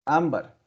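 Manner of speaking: "Ámbar" with a strong first A, falling away; stress on first syllable. Shown as a demonstration. Teacher giving a tonic-A example, stressed first syllable. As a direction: lean on the opening A of "Ámbar". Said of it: "la sílaba más fuerte".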